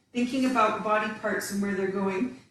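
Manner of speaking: usual speaking voice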